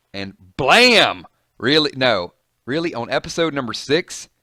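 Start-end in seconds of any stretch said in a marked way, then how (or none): 0.1-1.3 mock gunshot. Gunshot in the comic.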